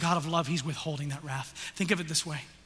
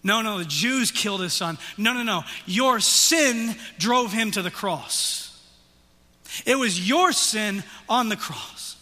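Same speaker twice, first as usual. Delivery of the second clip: loud, high, driving sermon voice; fiery preaching; 6 semitones higher, 7 dB louder than usual. Acted. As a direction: press the guilt home from the pulpit. Preaching at full force.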